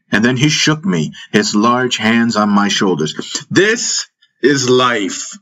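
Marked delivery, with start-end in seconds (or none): 3.5-5.4 high, very drawn-out forceful cry; yelling. A narrator's reading. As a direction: yell it slowly, hammering each word. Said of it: "he yelled at me".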